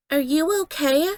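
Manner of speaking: put-on high, sweet voice of a young animatronic girl; polite little girl. A narrator's reading. Chicken girl kindly asking the crying narrator if she's okay. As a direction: ask gently, like a kind little girl checking on a friend. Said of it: "she asked me politely".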